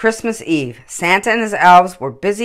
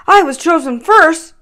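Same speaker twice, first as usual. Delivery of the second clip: much higher, louder, huffy reindeer; squabbling Dasher; 13 semitones higher, 8 dB louder than usual. Acted. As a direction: insist like a sulky child. Reindeer quarrel voiced.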